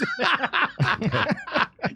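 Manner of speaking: high burst of laughter. Real, not acted. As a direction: laugh out at the joke. Laughing at own joke.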